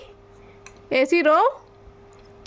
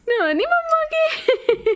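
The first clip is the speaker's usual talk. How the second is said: put-on much higher, slow laughing baby talk; 12 semitones higher, slower than usual. Shown as a demonstration. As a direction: squeak it like a baby, slowly. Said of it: "ऐसे गई थी". (ऊंची पतली आवाज़ में तुतलाते हुए)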